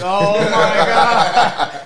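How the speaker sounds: very slow, loud laughing oh my God; laughing disbelief. Retold or imitated. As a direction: drag it out through a laugh. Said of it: "I was like".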